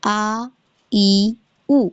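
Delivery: clean glottal a, i, u from the throat base; plain hamza vowels. Shown as a demonstration. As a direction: say the vowels simply, no squeeze. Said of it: "کیسے جائے گی؟".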